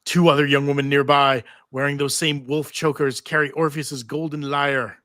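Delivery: ordinary delivery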